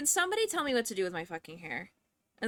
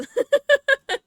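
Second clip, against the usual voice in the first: a sudden shrieking burst of laughter; big squealing laugh; loud high cackle; 12 dB louder than usual. Real, not acted. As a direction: cackle loudly at your own rant. Laughs at own outburst.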